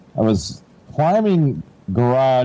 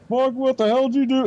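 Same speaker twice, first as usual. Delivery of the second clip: higher, exasperated mock yell of an annoyed dad; angry dad; 12 semitones higher than usual. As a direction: bark it like a fed-up father. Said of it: "he's more mad about".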